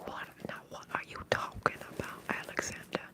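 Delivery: normal talking voice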